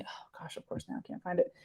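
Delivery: whispered aside while searching; muttering to herself. Real, not acted. Teacher muttering while hunting for the picture, whispered. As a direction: breathe it under your breath, frustrated.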